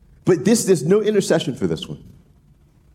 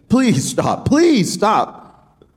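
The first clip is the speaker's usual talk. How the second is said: lightly voiced begging, rising on the second plea; 7 semitones higher, much slower than usual. Amos begging God to stop, mildly pitched up. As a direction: ask God to stop, a touch higher. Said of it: "He's begging".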